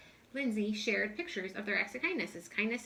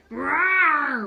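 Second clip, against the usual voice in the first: huge loud roar, bellowed out; lion's roar; 5 semitones higher, 13 dB louder than usual. A narrator's reading. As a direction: roar like a big lion woken up. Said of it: "roared the lion".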